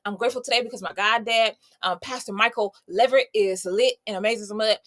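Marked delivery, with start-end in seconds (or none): none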